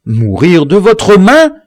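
aghast at dying by his hand; horrified plea. A narrator's reading. The victim questions his killer, slow and horrified.

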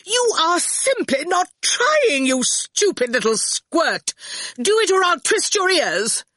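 high, slow, shrieking tirade; lost temper. A narrator's reading. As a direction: scold furiously at a very high pitch. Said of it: "Torquil lost his temper".

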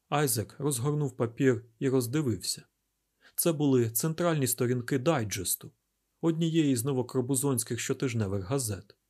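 plain reading voice

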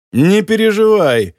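slow, high, scornful reassurance; dismissive snort. A narrator's reading. Villain brushes off his nervous accomplice, dismissive. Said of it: "фыркнул Моретти".

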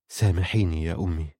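low, hushed plea for forgiveness; subdued, quiet apology; soft plea. A narrator's reading. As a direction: ask forgiveness softly, voice dropped low. Tearful son begging mother's forgiveness.

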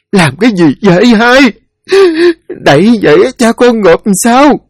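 put-on high, loud, frantic old wife; distressed protest. Widow stopping her son covering his father's face, frantic.

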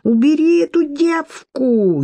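high, slow, wailing demand; wailing woman. A narrator's reading. Wet Lidia demands the girl be removed, wailing. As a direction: whine it out through spluttering. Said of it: "сквозь поток её причитаний".

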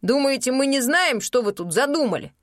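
accusing. Acted. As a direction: throw the accusation at them. Innkeeper accuses the sorceresses.

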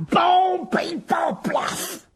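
loud, high comic-book impacts; imitated bangs ending in a breathy splat; crash noises. Crash noises read out by narrator.

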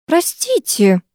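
high, meek interruption; plaintive. A narrator's reading. Onlooker interrupting the pair, plaintively. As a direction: timidly pipe up with an apology. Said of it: "раздалось жалобно откуда-то сбоку".

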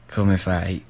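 normal talking voice

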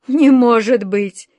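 be honestly astonished; sincere surprise. A narrator's reading. Alyosha truly surprised on the phone, slow and amazed. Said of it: "искренне удивился волшебник Алёша".

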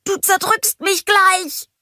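high, slow snowman voice, a touch strained; squeezed. A narrator's reading. Olaf crushed by Marshmallow, mildly strained. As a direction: squeak it out from inside the hug. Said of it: "sagte Olaf etwas gepresst".

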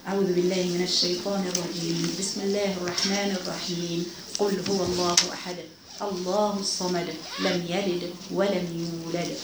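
slow, measured Quranic chanting, drawn out; Quran recitation. Acted. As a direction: recite each verse slowly for the women following silently. (تلاوة قرآن بطيئة ومرتلة)

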